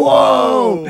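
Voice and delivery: high, loud "Whoa" as the raccoon jumps; retold startled cry. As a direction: yelp in surprise. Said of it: "I'm like".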